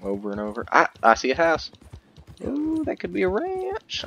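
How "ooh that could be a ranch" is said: much higher, slow delighted ooh; excited coo. Real, not acted. Spotting a possible ranch, high and slow coo. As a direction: coo at the discovery.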